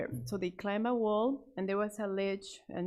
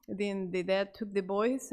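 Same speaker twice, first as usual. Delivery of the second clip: plain reading voice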